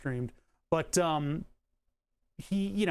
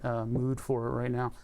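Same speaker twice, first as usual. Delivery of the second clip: ordinary delivery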